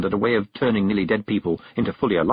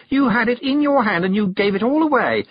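high-pitched scolding; sharp; 12 semitones higher, 4 dB louder than usual. A narrator's reading. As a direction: drive the reproach home sharply. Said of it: "said a sharp voice behind her".

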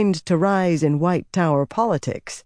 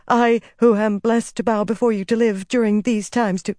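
quick, servile babbling; 6 semitones higher than usual. A narrator's reading. Trembling servant flattering the dark figure, servile babbling. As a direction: babble submissively, pitched up, until cut off. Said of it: "Shiriam said quickly".

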